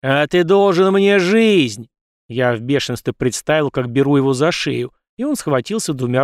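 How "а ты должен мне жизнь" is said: slow, loud, furious shout. A narrator's reading. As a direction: hurl it at him in rage. Hero in fury at guard.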